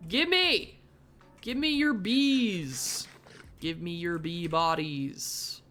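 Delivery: grab at them like a greedy goblin; hammed-up yelling at the bees; greedy demand.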